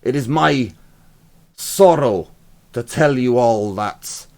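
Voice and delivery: loud, stern proclamation to the Hive; angry Lord Commander. Acted. Lord Commander addressing the citizens with angry force. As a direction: bellow it like a wrathful commander. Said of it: "I'll try and do the, uh, the angry one".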